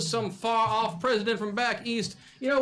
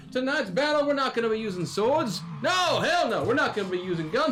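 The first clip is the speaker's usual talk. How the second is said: big booming ring announcer; hype announcer; 3 semitones higher than usual. Acted. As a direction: pump up the crowd, announcer style. Announcer hyping the crowd.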